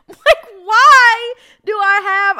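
very high, loud, drawn-out shriek; unhinged, squealing outrage; crazy voice. Acted. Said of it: "I'm getting into my crazy voice right now".

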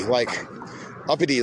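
normal talking voice

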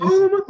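high-pitched imitated blast; quick boom. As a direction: pop out a short, high boom.

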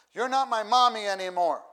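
put-on high, loud child's protest; a child yelling at mom; upset child. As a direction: shout it like a sulking little kid. Upset child quoted by preacher.

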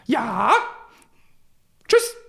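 short, loud brush-off; curt goodbye. Retold or imitated. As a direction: snap a quick goodbye. Agent hangs up.

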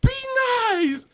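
high, drawn-out reprimand; high-pitched mock scolding; scolding mother. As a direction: imitate a mom calling out a telling-off. Repeats bystander mother's scolding.

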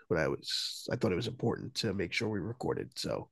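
hoarse, fading sick voice; voice giving out. Real, not acted. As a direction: voice cracking and dropping away. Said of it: "I apologize for how I sound".

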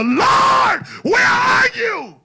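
huge high-pitched shout to heaven; upset cry to God. Acted. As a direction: cry out to God, drawing each word out long. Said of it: "God, I'm upset".

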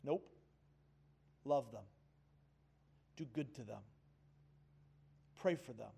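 a quiet, steady answer to a stubborn heart; calm Jesus. Retold or imitated. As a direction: gently settle the matter. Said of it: "Jesus says calmly".

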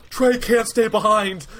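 slow emphatic mock outcry; mock group protest. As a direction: object like the whole bus at once. Whole bus objecting to the professor.

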